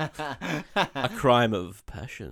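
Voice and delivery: very slow, drawn-out mock trailer voice; hammed-up dramatic line. Host hams up the film's plot for his co-host, slow and dramatic. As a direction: drag it out like a cheesy movie tagline.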